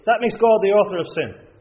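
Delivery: mock outrage of the critics; indignant objection. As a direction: throw out the objection with scorn. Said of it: "just as they say today".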